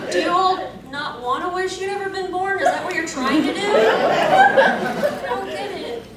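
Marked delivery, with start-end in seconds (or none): 3.6-6.0 high, slow, laughing; laughing disbelief. Real, not acted. Cosplayer laughing at the gloomy audience words, high and slow. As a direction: laugh it out, stretched and baffled.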